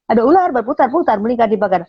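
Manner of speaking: fast, pushed out; rushed student imitation. Teacher imitating how the student said the twister, rushed. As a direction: rattle it off hurriedly. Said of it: "Dia ngomongnya".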